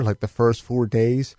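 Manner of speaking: ordinary talk, nothing marked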